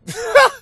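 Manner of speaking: high, loud laugh; burst of laughter. Real, not acted. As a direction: laugh out at your own rant. Laughs after outburst.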